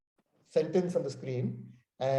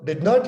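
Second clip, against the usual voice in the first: "not" pushed louder, a denying "did not"; stress on NOT; 6 dB louder than usual. Shown as a demonstration. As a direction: punch "not" to deny it. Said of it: "you're denying".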